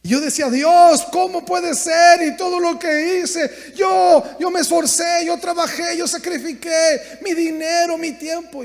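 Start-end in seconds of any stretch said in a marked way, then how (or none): 0.5-1.0 loud, high cry of Dios; anguished outcry to God. Retold or imitated. Crying out to God in complaint. 1.1-2.2 higher, louder, slow anguished question; protesting cry at God; loud outcry. Retold or imitated. Crying out to God in complaint. 2.2-8.6 pleading grievance, raised voice; shouted protest. Retold or imitated. Protesting to God about sacrifices.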